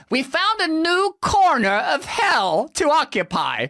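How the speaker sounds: slow, lightly voiced gloating planner; mock smug official. Mocking planners who celebrate a new hell, slow and smug. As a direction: sneer it out as the powers that be. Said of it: "Not work out".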